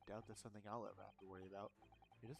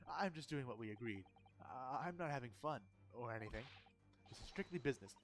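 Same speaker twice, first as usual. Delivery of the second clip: loud defensive protest; 8 dB louder than usual. Acted. Defensively denying enjoying himself.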